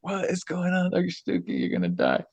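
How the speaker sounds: mock alarm. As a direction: imitate worried colleagues in a high, small voice. Retelling alarmed colleagues standing over him.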